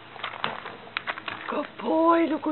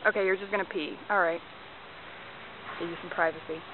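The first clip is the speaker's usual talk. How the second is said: quiet aside; 8 semitones lower, 7 dB quieter than usual. Real, not acted. Dog stops to pee.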